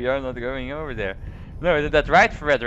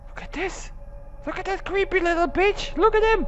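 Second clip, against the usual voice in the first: mocking taunt. Real, not acted. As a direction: taunt it in a high voice. Mocking a creepy game child.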